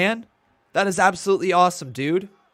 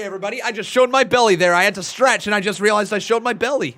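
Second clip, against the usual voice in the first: ordinary delivery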